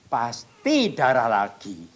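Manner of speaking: "Pasti" drawn out loud and slow; yakin cemas. Retold or imitated. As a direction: stretch and hit "Pasti" hard. Yakin akan berdarah lagi. (kata "Pasti" ditekan keras dan dipanjangkan)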